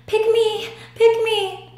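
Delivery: high, pleading cries of little socks; eager begging socks. Acted. Socks calling to the hand, high and eager. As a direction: beg to be chosen, high and eager.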